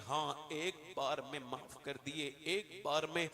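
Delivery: voiced Moses reassuring. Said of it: "कहने लगे".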